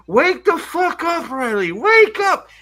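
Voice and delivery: high-pitched, drawn-out acted yell; Joy's frantic shout. Joy trying to rouse Riley atop a building, frantic shout. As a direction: scream it like a desperate wake-up call. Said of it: "And Joy's like".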